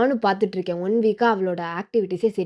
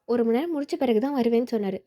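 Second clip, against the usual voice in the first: normal talking voice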